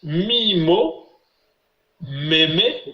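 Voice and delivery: syllable by syllable, drawn out; récitation modèle. Shown as a demonstration. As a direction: recite the syllable drill for pupils to copy. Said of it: "on va apprendre facilement". (récitation syllabe par syllabe)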